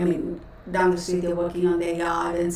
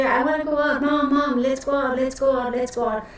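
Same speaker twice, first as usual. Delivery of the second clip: higher-pitched, imitating an eager, pestering dog; playfully pleading, pitched up like an impatient kid; restless begging dog; 5 semitones higher than usual. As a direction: mimic a dog begging Mom to go out. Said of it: "like a cat on a hot tin roof".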